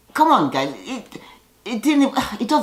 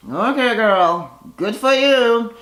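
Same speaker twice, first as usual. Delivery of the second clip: sarcastic approval; 6 semitones higher, slower than usual. Real, not acted. To viewers about the tattoo, slow mocking approval. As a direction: drawl it out like an unimpressed friend. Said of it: "sarcastic aside to viewers".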